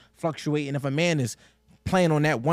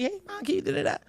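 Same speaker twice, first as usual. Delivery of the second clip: put-on small, high-pitched kid's plea; child asking mom; 5 dB quieter than usual. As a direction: ask mom softly in a much higher voice.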